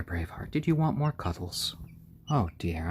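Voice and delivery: normal talking voice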